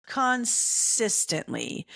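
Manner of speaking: Consistently stretched long and slow, each syllable leaned on; exasperated slow stress on Consistently; drawn-out emphasis. Retold or imitated. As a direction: drag out Consistently to hammer the point home. Stressing wife is always cold.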